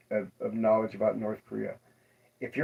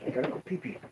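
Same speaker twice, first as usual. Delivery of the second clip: softer, breathy whisper; 7 dB quieter, faster than usual. Pretend. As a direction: whisper it like a secret. Repeats the joke in a whisper.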